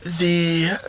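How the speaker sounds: very slow, weighted storytelling; solemn reassurance. Acted. Charlie consoles Baptiste about the broken statue, slow and solemn. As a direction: deliver it slowly, like a parable.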